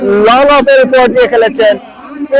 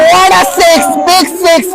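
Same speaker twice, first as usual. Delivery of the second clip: higher shouted call; excited cricket commentary; 6 semitones higher, much slower than usual. Real, not acted. Commentator reacts to six. (উচ্ছ্বসিত চিৎকার)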